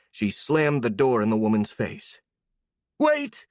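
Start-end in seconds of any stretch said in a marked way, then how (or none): 3.0-3.5 high pleading shout; desperate cry. A narrator's reading. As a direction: call out before the door shuts. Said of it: "Gertrude cried".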